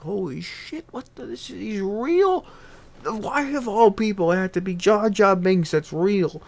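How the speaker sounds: high, incredulous outburst; can't believe what he's seeing; stunned disbelief. Acted. Danny DeVito shocked to find Jar Jar real, high incredulous cry.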